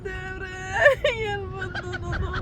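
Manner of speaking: crying. Real, not acted. Captive laments her luck to God, crying. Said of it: "ಅಕ್ಕ, ಅಳ್ಬೇಡಿ ಅಕ್ಕ".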